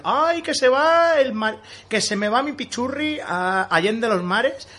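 high, slow theatrical lament; melodramatic first lady. Retold or imitated. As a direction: wail like a swooning wife. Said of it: "suele pasar con las primeras damas".

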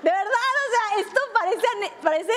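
laughing through the words, pitch jumping up. Real, not acted. Preacher laughs at her own story to the congregation, laughing. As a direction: she breaks up laughing mid-sentence.

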